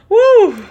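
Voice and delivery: a high, loud whoop after laughing; whooping woo. Real, not acted. Commentator whooping at her own joke, loud and high. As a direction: let out a big whoop of delight. Reacting to Ike story joke.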